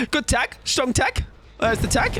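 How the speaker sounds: high, excited praise; hype. Real, not acted. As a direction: cheer your own move. Hyping up his own tag.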